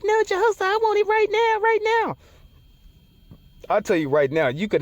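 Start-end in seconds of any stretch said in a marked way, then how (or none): 0.0-2.2 high, whiny demand; impatient woman voice. Retold or imitated. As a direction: beg like an impatient girlfriend. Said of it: "they be like".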